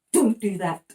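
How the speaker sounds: loud teasing telling-off; playful mock scold. Shown as a demonstration. Parent tickling baby, loud mock telling-off. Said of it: "tickle them at the end and say".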